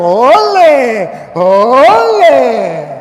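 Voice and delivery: long swooping olé; mock bullfight cheer. Priest mocks Catholics dodging the word like toreros, mock cheering. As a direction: stretch each olé out like a bullring cry.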